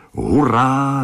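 high, loud cheer; mock child's hooray. Happy cheer.

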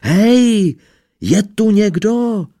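very high, loud shout into the empty house; shouting as loud as he can. A narrator's reading. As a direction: yell as loud as you can. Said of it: "se rozkřikl, co mohl". (křik z plných plic)

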